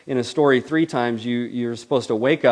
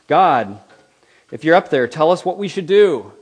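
loud and higher, calling up to heaven; shouting at the sky; 5 semitones higher, 10 dB louder than usual. Acted. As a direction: yell a challenge upward.

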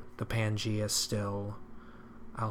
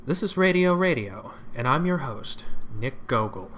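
higher, louder booming announcer intro; radio host voice; 3 semitones higher, 6 dB louder than usual. Shown as a demonstration. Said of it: "let me take that again".

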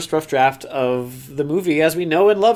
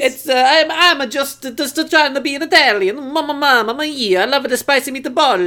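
sing-song mock-Italian, pitched way up; Italian accent; 9 semitones higher than usual. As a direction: play a broad cartoon Italian. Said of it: "make this offensive Italian accent".

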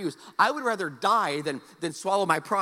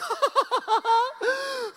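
a high, crying sob of relief; half-laugh, half-cry as rescue comes; hammed-up sob of sheer relief; relieved cry; 6 dB louder than usual.

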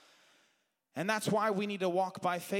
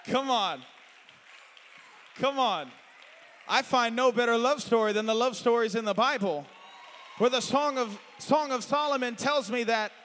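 loud, high preaching cry; full-force preaching; 5 semitones higher, 8 dB louder than usual. Acted. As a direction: preach it hard to the congregation. Preacher ramps back up.

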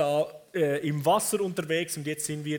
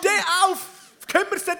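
high-pitched pleading shout, easing in volume; panicked high cry that loses force; screaming disciples; 11 semitones higher, 5 dB louder, faster than usual. Acted. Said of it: "die schreien".

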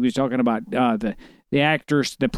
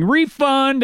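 high-pitched called-out quote; lightly raised, much higher shout from an article; mock crowd shout; 11 semitones higher than usual. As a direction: call it out like a heckling crowd. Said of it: "the crowd was shouting".

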